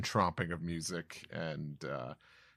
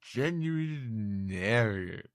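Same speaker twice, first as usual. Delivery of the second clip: higher, louder, slow booming giant; giant chief voice; 4 semitones higher, 8 dB louder, slower than usual. Acted. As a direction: announce it proudly like a big chief. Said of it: "he says".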